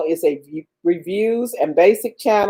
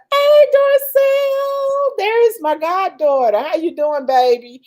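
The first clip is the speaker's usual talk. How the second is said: reading Dora's greeting; 10 semitones higher than usual. Retold or imitated. Reading a fan's greeting aloud in a gushing voice. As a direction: call out like a delighted godmother. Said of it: "Dora said".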